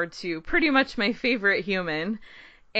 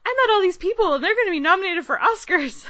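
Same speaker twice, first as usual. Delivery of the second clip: high, loud imitation of excitement; excited; 11 semitones higher, 4 dB louder than usual. Mom retold after meeting nominees, high and excited. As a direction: gush in a raised, thrilled pitch. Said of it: "just so excited too".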